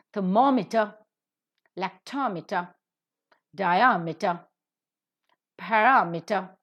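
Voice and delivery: thermometer, lactometer, diameter, parameter, each accented just before -meter; stress third from last. Shown as a demonstration. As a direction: model ther-MOM-eter, lac-TOM-eter, di-AM-eter, pa-RAM-eter. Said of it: "will take up third from the last".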